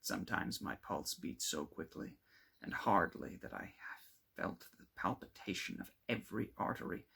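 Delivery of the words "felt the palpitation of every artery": plain reading voice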